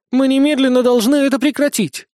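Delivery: high, insistent demand; urgent protest. A narrator's reading. Witch demanding the boy stop at once, urgent protest. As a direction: demand an end to it, flustered.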